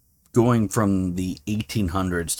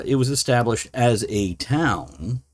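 ordinary talk

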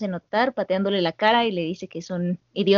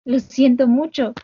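breaking down; 3 semitones higher, slower than usual. Retold or imitated. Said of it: "se derrumba y le dice".

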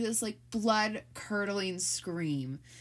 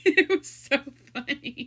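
laughing; 6 semitones higher, 9 dB louder than usual. Real, not acted. Laughing at memory.

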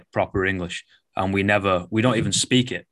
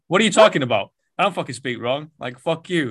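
higher-pitched mock outrage; protest, offended and incredulous; indignant retort; 7 semitones higher, 4 dB louder, faster than usual. Coach told he speaks wrong, indignant retort. Acted indignant reply to being corrected.

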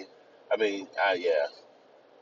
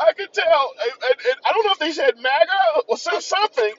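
high loud falsetto impression; mock woman voice; 6 dB louder than usual. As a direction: keep the shrill parody going. S0 still in falsetto Gail voice.

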